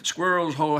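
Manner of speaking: plain reading voice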